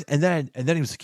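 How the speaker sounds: usual speaking voice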